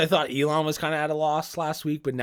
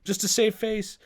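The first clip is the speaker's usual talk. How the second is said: high, frantic mock voice of a desperate investor; a billionaire about to lose everything, pitched high and frantic; 8 semitones higher than usual. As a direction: panic under a show of confidence. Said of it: "And he's like".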